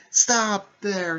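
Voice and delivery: high, loud call to halt; mock shouted plea. Girl to two fighters about to collide, imitated yell. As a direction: imitate someone yelling to stop a fight. Sakura calls to stop the fighters.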